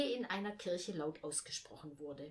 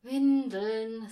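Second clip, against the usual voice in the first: loud, weary sigh on WINDELN; sighed correction; 3 semitones higher, 11 dB louder than usual. A narrator's reading. Mother corrects the boy's misheard word, sighing. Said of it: "seufzte Mutter".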